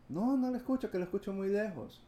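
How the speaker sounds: high-pitched imitated complaint; complaining caller. Caller tells agent he sounds far away, complaining. Retelling caller who cannot hear him.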